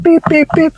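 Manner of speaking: very high mock beep beep beep; horn beeps. Teacher imitating a taxi driver honking, very high beeps. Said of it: "he's like".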